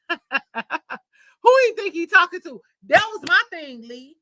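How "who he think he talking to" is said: laughing disbelief. Real, not acted. Laughing at his nerve.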